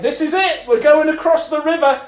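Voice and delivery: high eager announcement; excited. Retold or imitated. Wife in the crash, high excited announcement. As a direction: say it eager and thrilled. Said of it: "she was like".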